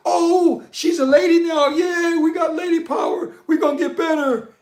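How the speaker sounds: high, loud mocking voice with a laugh; mocking feminists. Mocking Western feminists talking about lady power, high, loud, hammed-up. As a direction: lampoon them in a shrill-pitched, hammed-up voice. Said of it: "They trying to say".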